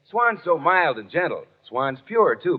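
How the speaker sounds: ordinary talk, nothing marked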